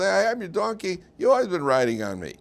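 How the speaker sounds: a hammed-up donkey, indignant; donkey pleading. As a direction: protest like a wronged donkey. Donkey voiced, loud.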